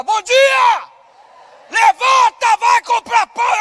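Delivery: high, loud bellowing wake-up call; mother shouting her son out of bed for bread; mother's yell. Retold or imitated. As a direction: holler at a sleeping boy to get up.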